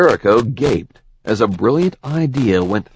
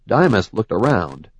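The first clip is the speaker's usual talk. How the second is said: usual speaking voice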